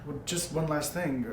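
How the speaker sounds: ordinary delivery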